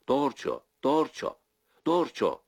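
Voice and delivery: high, strong calls into the dark; loud calling. A narrator's reading. Andreşko chasing his runaway horse, strong loud calls. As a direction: shout the horse's name as you chase it. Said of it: "gür bir sesle".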